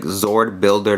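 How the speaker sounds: slow, deliberate Zord Builder; carefully paced, avoiding a stumble. Shown as a demonstration. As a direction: say it slowly so it comes out right. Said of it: "have to say it kinda slowly".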